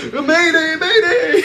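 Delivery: cracking up mid mayday; laughing mayday. Retold or imitated. Host redoes the pilots' call, laughing. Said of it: "they were like".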